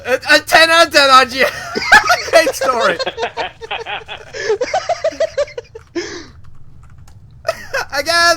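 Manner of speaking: words squeezed out through loud laughter; crying with laughter, praising; high, loud, teary laughing talk; laughing hard. Real, not acted. Friend reacts to absurd story.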